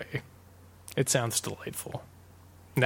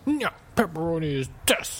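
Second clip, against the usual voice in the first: stubborn guy; 4 semitones higher than usual. Retold or imitated. Stubborn guy on the boat refusing to give in, mock insistence. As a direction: dig in stubbornly and land hard on "this". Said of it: "just some stubborn guy".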